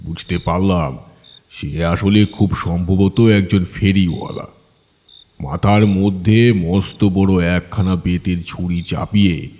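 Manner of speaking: ordinary delivery, nothing marked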